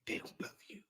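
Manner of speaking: they don't love you dropped to a whisper; whispered repeat. Shown as a demonstration. Repeating his point to listeners, ending in a whisper. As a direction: let the last words fall to a bare whisper. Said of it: "Say this again".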